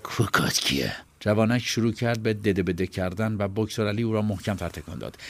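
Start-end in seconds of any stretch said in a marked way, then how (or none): 0.0-1.0 a low, menacing, breathy growl; hissed threat, low and close; threatening growl. Acted. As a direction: growl the question right into his face. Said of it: "با صدای تهدیدآمیزی غرید".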